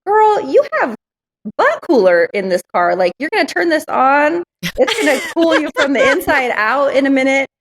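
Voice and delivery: high-pitched, sassy self-talk; exasperated, hammed-up disbelief; incredulous scolding. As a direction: give yourself a playful telling-off, pitch leaping up. Acted scolding of herself in hot car.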